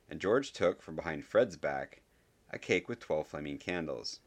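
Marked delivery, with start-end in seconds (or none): none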